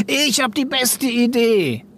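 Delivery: high, drawn-out eager boast; eager pupil. A narrator's reading. Lev bursting with an idea, high eager voice. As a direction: push it out high, bursting to share. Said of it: "So machte er das immer".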